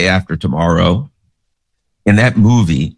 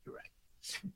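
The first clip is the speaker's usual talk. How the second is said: quiet agreement; 14 dB quieter, much slower than usual. Retold or imitated. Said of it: "smiles, he goes".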